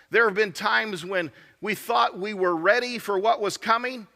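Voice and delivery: ordinary delivery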